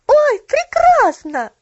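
very high, loud delighted cry; joyful exclamation. A narrator's reading. Child struck by a happy idea, loud joyful cry. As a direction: burst out with drawn-out childlike joy. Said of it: "воскликнула она радостно".

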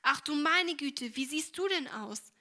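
a loud, slowed, shocked exclamation; horrified cry. Acted. Mother, horrified at her filthy daughter, cries out. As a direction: gasp it out in dismay at the dirty child. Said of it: "rief sie entsetzt".

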